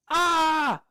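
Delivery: short sharp frustrated yelp; stifled scream. Real, not acted. Streamer to chat, a short frustrated yelp. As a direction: let out a quick cry of frustration. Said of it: "I wanted to scream".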